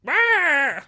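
high squawking gull call; seagull cry. Pretend. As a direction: screech like a seagull. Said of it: "Can you do an impression of a seagull".

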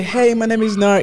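high, bright welcoming greeting; energetic. Retold or imitated. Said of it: "it's the energy. It's, like, welcoming".

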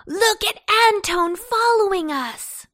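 high, loud jeering call; taunting pumpkin shout; gleeful yell. A narrator's reading. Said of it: "yelled Pumpkin".

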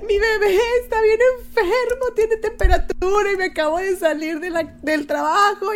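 high, wailing mock sob to the cop; fake crying plea. Faking tears to the traffic cop to get out of a fine. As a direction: ham up a teary excuse. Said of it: "ya estoy llorando".

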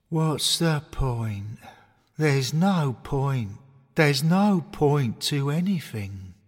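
slow, despairing bear voice; gloomy bear. Acted. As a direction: drag out a hopeless sigh of words. Despondent bear moping.

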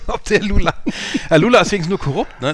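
tipsy, giggly and loud. Real, not acted. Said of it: "ich bin betrunken".

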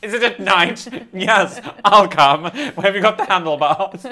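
eager joking invitation; excited. Real, not acted. Volunteering to join the rabbit rides, excited and laughing.

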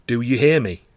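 firm, steely question. A narrator's reading. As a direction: demand an answer with steel in the tone. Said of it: "with steel in his tone".

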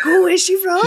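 high, loud mock surprise, almost shouted; puzzled listener shouting her question; surprised call. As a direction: call out in amazement at the accent. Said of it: "I was like".

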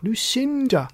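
insistent second attempt to get attention; repeated call. A narrator's reading. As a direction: call "Lucinda" high, stretching the middle. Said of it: "he tried again".

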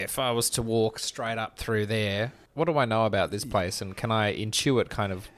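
usual speaking voice

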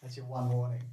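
quiet half-joking warning; mock stern warning. Husband threatening to leave, softly mock stern. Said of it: "You think I'm joking?".

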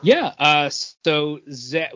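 ordinary talk, nothing marked

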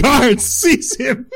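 slow, high, drawn-out royal command; roaring tyrant king. Pretend. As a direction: bellow it like a tyrant king ordering his guards. Mock king ordering arrest.

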